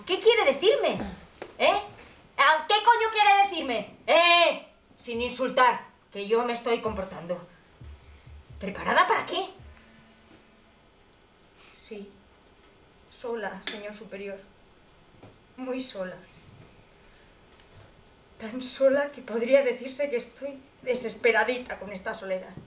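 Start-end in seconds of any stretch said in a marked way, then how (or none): 0.1-4.7 indignant. Acted. Old woman on the phone, offended by the officer's questions. 11.8-22.5 soft, low, lonely plea; self-pitying. Acted. Old woman telling the officer how lonely she is, quietly.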